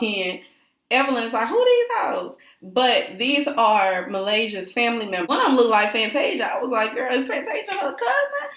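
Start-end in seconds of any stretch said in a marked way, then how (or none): 1.5-2.4 high-pitched, imitated disbelief; mock incredulous question. [name] reacting to family members walking in, mock incredulous. 6.9-8.5 high-pitched, drawn-up disbelief; mock astonished question. Reacting to a family member who looks like [name], mock astonished. Own joking reaction retold.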